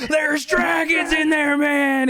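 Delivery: high, drawn-out stoner wail; hammed-up high-pitched panic; panicked stoner. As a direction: wail a warning in mock terror. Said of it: "He's like".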